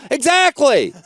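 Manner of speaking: high, emphatic comeback; retold yelling. Comic yelling at his opener about material, exasperated. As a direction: drive the point home, exasperated. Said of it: "I was yelling at him".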